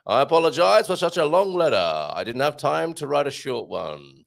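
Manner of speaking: put-on old American voice reading a quotation; mock old-time American orator; old American accent. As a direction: do a generic old American accent, hammed up a little. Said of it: "I will do my old American accent now".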